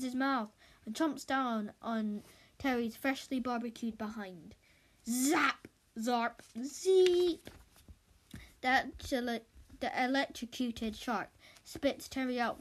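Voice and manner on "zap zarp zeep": loud, rising zap noises; electric zaps. Acted. Shark getting electrocuted, zapping noises. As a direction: crackle each zap.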